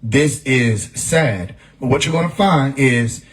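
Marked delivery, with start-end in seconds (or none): 0.0-1.5 name the feeling slowly, without feeling it; very slow, detached label; very slow "This is sad". Shown as a demonstration. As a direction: drag out each word, flat and level. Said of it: "You can identify it and say".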